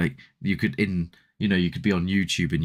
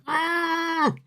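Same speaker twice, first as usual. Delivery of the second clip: very high squeal of fright; mock frightened squeal; startled yelp. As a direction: shriek as if the thing jumps at you. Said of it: "So you're like".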